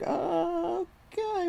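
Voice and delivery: a high, drawn-out, skeptical "okay"; mock resignation, half a laugh in it; sarcastic okay. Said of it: "so I'm like".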